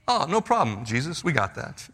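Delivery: teasing imitation of overconfident disciples; glib. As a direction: shrug it off carelessly. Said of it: "rather glibly".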